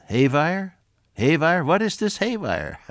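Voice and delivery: high puzzled voice, German-sounding "haywire"; puzzled German interpreter. Retold or imitated. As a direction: ask it like a baffled German lady. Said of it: "she said".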